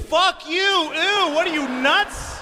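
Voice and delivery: very high, incredulous comic yelling; hammed-up parental outrage; outraged rant. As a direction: shout it way up high, appalled at the child. Ranting at daughter rejecting medicine.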